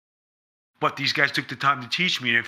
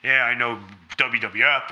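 usual speaking voice

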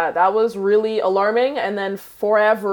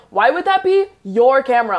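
loud, raised retort, retold; indignant; 4 semitones higher, 5 dB louder than usual. Waking camera owner confronts the thief, indignant. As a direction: challenge him in disbelief, louder and higher.

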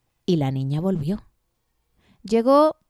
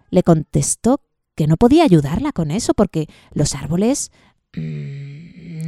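ordinary delivery, nothing marked

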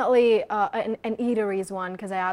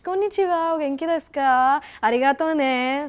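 higher, bright Japanese greeting; Japanese phrases; 4 semitones higher, 4 dB louder than usual. Shown as a demonstration. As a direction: show off Japanese with a lift. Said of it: "I love their language".

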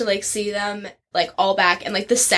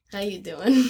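Joey impression. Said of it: "And Joey".

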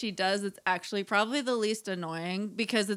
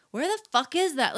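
put-on angry teen; 7 semitones higher, 4 dB louder than usual. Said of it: "my daughter's just like".

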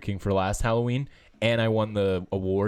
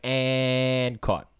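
mock announcer stretching out "And"; drawn-out play-by-play imitation; Joe Buck; 3 semitones higher than usual. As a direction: hold the suspense, then call the catch. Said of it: "he's like".

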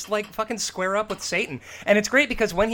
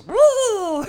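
squeaky high puppet woo; high puppet whoop; 6 dB louder than usual. Retold or imitated. Puppet sound imitated.

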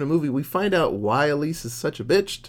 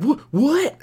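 shocked what; 13 semitones higher, 4 dB louder than usual. Retold or imitated. Elise stunned dance cancelled.

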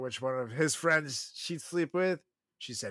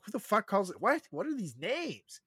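mock bafflement; 10 semitones higher than usual. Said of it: "it's like".

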